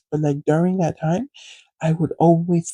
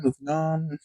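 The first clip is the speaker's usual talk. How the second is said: much softer repeat, letting it trail away; fading, dismissive; 8 dB quieter than usual. Retold or imitated. Brushing off the weird moment with her classmate, trailing off quietly. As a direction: drop the voice as you brush it off. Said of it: "You know what?".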